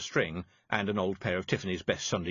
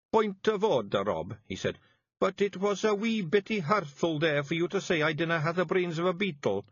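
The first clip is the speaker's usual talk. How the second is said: a hint of a hurt Feegle; 8 semitones higher than usual. A narrator's reading. Wullie complains.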